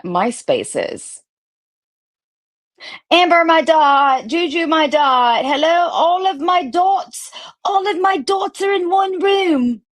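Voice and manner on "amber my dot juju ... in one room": high, squealing mock sweetness; a cooing parody of a fake-sweet friend; mocking gushy friend. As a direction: ham up her sugary gushing, much higher. Mocking Jules's gushing message.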